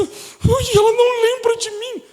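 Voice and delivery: high, whiny childlike mock voice; childish whiny believer. Preacher mocking a childish believer, high and whiny. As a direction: mimic a babyish churchgoer whining. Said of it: "Tem crente que é tão criança".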